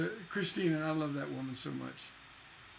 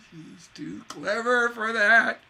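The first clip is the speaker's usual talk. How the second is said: much louder and slower, a bit higher; slow emphatic proclamation; 4 semitones higher, 10 dB louder, slower than usual. Shown as a demonstration. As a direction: proclaim it slowly with admiration. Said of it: "Yeah, she's too clever".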